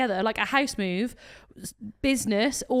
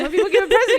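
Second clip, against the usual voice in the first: laughing; 7 semitones higher, 8 dB louder, faster than usual. Real, not acted. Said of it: "laugh heard".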